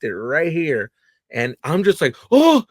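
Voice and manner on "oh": high, loud yelp of pain; sudden high ouch. Retold or imitated. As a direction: yelp as the knife hits your finger. Slicing his finger on bagel.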